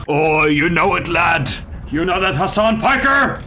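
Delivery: high, menacing put-on voice; mock villain threat. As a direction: taunt Hassan in a cartoon villain voice. Mock threat to Hassan Piker.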